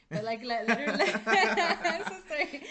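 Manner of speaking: loud, high laugh breaking through the words; giggling sorry through real laughter; laughing apology. Real, not acted. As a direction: crack up while apologizing.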